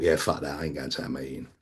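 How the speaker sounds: low muttered denial to himself; dismissive inner mutter. Retold or imitated. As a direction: mutter it under your breath, low and dismissive. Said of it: "and I thought".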